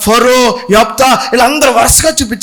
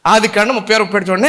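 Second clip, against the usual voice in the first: usual speaking voice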